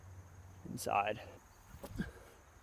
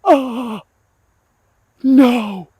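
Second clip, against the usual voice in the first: loud, high "oh no"; shocked cry; 13 semitones higher, 16 dB louder than usual. Real, not acted. Disc golfer watching his ace chain out, shocked cry. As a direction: yell as the ace spits out.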